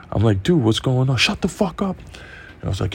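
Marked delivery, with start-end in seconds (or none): 0.4-1.2 raised, higher-pitched retold protest; alarmed protest. Acts out himself protesting to cops. 1.2-2.0 high-pitched mock command; retold snapped order. Acts out cop cutting him off.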